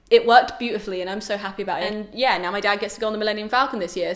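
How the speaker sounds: normal talking voice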